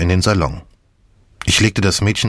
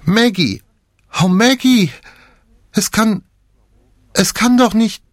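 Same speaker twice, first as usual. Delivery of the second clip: shrill scream; 13 semitones higher than usual. A narrator's reading. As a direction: cry out in shock and horror. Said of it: "Sie stieß einen schrillen Schrei aus".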